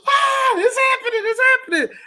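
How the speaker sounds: high thrilled squeal; excited kid. Retold or imitated. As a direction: gasp with childhood excitement. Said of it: "as a kid".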